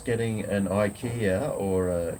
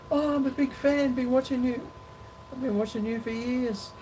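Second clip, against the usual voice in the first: much higher, squeaky eager stranger voice; imitating a fan. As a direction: mimic the excited fan, way up high. Retelling a fan in the park.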